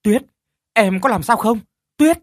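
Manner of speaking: high, loud frightened cry; husband fearing for his wife, panicked high call. Acted. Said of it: "Khánh hoảng hốt".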